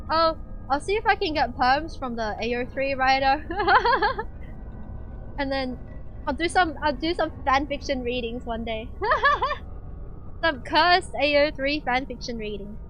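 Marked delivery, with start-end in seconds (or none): 3.5-5.3 a high bubbling giggle. Real, not acted. Laughs at fanfic idea. 8.9-10.4 a high bubbling giggle. Real, not acted. Laughs at cursed fanfic.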